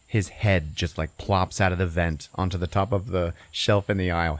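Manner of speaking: usual speaking voice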